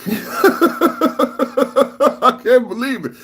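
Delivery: laughing in disbelief; high, breaking into laughter. Real, not acted. Laughing at absurd text.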